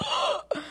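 quick breathless gasp of awe; sharp gasp. Retold or imitated. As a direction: gasp as if moved. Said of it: "I was like".

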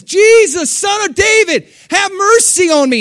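high, loud desperate cry for mercy; yelling above the crowd. Retold or imitated. Blind beggar yelling for Jesus above the crowd, desperate cry. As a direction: call out over a throng, begging. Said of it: "he's yelling above the crowd".

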